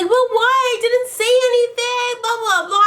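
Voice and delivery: high, put-on protesting voice; mock victim. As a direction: mimic her playing the innocent victim. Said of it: "trying to act like she's the motherfucking victim".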